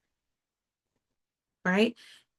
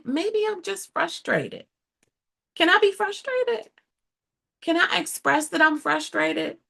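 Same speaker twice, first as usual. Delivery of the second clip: frustrated; 7 semitones higher than usual. Acted. Pleading with a listener who takes offence, high and frustrated. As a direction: pitch jumping up high with frustration. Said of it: "people hear frustration".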